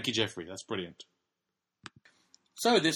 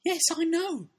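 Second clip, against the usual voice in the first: very high, loud put-on girl's voice; hammed-up falsetto protest; distressed Emily; 6 dB louder than usual. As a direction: squeal it back at a disbelieving father. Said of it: "Emily replied".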